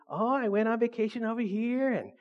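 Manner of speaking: soft, affected mock boasting; bragging. Preacher mimicking proud people showing off, airy bragging. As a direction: show off airily, drawing out the possessions. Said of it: "They're always bragging".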